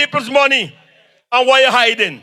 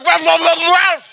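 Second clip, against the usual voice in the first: dogs barking name; 4 semitones higher than usual. Retold or imitated. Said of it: "like how the dogs just call it".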